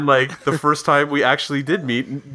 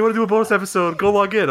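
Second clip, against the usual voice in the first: mock eager friend; 7 semitones higher, faster than usual. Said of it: "He's like".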